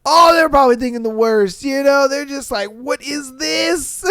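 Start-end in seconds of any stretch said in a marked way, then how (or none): 2.7-4.0 high, slow drawn-out shout; hammed-up shocked parent; mock horrified question. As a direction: hold up the thing in disbelief. Parents finding the toys.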